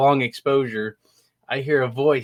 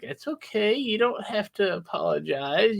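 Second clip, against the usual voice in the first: gentle reassuring; 8 semitones higher, slower than usual. Retold or imitated. Soothing the ghost.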